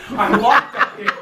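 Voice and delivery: ordinary talk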